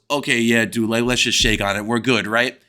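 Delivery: very low imitation of the kid; mocked kid's voice. Punched kid offering a handshake, mock low voice. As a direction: drop way down to mimic the kid making peace.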